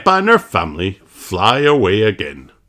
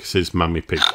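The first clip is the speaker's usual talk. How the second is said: plain reading voice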